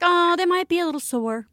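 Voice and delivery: breezy doctor imitation. Mother mimics pediatrician brushing off vaccine side effects, lightly breezy. Mom retells doctor's reassurance.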